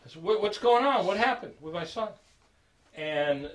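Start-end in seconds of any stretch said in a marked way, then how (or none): 0.2-2.1 high, loud, urgent questions; imitated parental alarm, raised voice; alarmed questioning. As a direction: act out an alarmed father demanding answers. Father demanding explanation about son.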